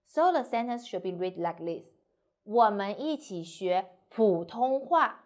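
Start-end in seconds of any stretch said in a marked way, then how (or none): 2.2-5.2 slow, with 普通话 drawn out and emphasised; stress on 普通话. Shown as a demonstration. Teacher saying Mandarin, not other language, stress on 普通话. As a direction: hit 普通话 at the end. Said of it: "we need to stress 普通话".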